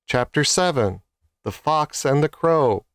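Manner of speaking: ordinary delivery, nothing marked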